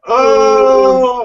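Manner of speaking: loud anguished "Oh!" shout; pained man's cry. Retold or imitated. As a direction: cry out like a man stuck. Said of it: "he goes".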